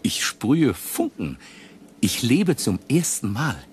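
euphoric outburst. Acted. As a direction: burst out with ecstatic joy. Euphorischer Ausbruch. (euphorisch, laut und hoch)

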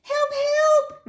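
put-on very high little-girl cry; cry for help. A narrator's reading. Niece calling from the tower, high-pitched cry. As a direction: call out like a distressed damsel. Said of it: "Minuet cried".

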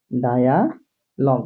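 Dialogue মানে spoken very slowly and drawn out; slow demo. Shown as a demonstration. Teacher explaining the word to students, very slowly. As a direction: pull dialogue মানে apart slowly for learners. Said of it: "দেখছো?".

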